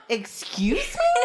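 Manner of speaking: high, slowly stretched incredulous question; mock disbelief. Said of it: "That's what he really sounds like".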